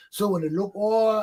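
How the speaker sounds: ordinary delivery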